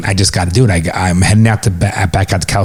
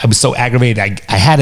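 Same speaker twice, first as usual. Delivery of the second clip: normal talking voice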